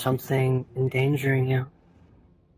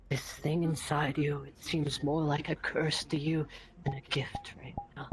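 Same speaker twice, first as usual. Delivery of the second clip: soft, hushed inner-monologue narration; 9 dB quieter than usual. Acted. Game master voicing the character's inner dread, hushed. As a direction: speak it like a fearful thought.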